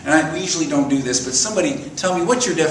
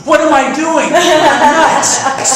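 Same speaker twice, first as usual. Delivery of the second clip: high, loud sudden panic at yourself; alarmed self-scolding; 13 semitones higher, 8 dB louder, much slower than usual. Real, not acted. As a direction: realize the danger, shocked and loud. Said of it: "he's half crazy".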